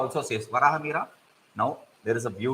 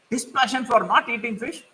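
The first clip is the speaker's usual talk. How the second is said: surprised crab; 8 semitones higher than usual. Acted. Crab questioning the crane.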